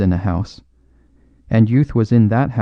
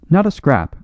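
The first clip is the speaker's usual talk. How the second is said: carried away; 7 semitones higher than usual. A narrator's reading. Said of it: "cried Blount, quite carried away".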